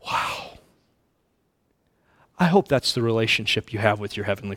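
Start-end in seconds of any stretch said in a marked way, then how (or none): 0.0-0.6 whisper. Real, not acted. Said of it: "awed whisper".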